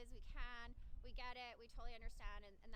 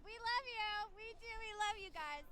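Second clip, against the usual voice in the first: gushing; 7 semitones higher, 8 dB louder than usual. Real, not acted. Gushing love to the police.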